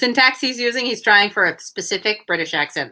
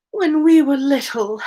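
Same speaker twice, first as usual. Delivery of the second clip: calmer, still sobbing; 6 semitones higher than usual. Acted. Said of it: "more calmly, though still sobbing".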